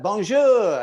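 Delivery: bright mock French bonjour; mock French hello; French greeting. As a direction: greet like a friendly local. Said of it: "these people will be like".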